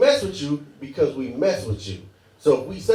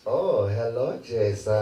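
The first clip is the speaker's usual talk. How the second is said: lower, creepy, smiling hello; sinister greeting; 7 semitones lower, slower than usual. Retold or imitated. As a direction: greet him with a sly, sinister smile. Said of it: "sinister smile".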